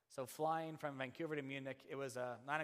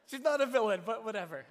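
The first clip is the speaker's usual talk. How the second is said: loud, laughing disclaimer; laughing aside; 10 semitones higher, 14 dB louder, faster than usual. Real, not acted. Preacher laughing at his own story.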